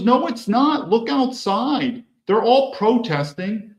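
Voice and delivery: high, pleading, slowed insistence; a girlfriend pointing out the window, high and earnest; insistent girlfriend. Retold or imitated. Bimbo girlfriend insists, pointing outside.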